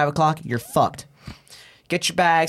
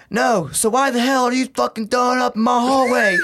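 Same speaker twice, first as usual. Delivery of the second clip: angry yell; 7 semitones higher, 4 dB louder than usual. Retold or imitated. Marine yelling at recruit.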